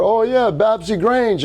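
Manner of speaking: high, slow Jamaican "Oh, yeah, Babsy Grange"; imitating Jamaican accent. Retelling the FaceTime guy naming the minister, in a Jamaican accent. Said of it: "a Jamaican accent".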